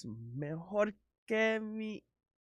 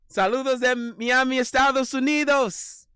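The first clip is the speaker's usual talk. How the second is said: excited shout; 10 semitones higher, 8 dB louder than usual. Real, not acted. Greeting shouted to viewers.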